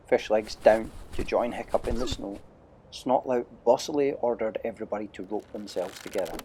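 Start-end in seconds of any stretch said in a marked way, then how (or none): none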